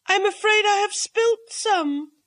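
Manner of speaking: a hint of a flustered lady apologizing; apologetic hostess. A narrator's reading. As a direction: hostess apologizing to the cat, pitched high. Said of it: "she apologized".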